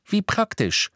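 high-pitched, cutting remark; sarcastic retort. A narrator's reading. Harry scoffs at Ron's excuse, sarcastic. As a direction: jab back mockingly.

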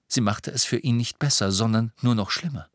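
plain reading voice

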